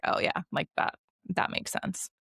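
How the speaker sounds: usual speaking voice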